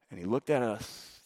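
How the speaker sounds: ordinary talk, nothing marked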